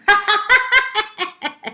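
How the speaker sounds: high mocking cackle of a rooster; rooster laughing. A narrator's reading. Rooster mocking the worried peahen, high cackle. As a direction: let the rooster burst out laughing at her. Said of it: "It started laughing".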